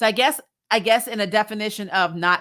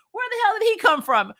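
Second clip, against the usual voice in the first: ordinary talk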